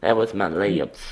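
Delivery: ordinary delivery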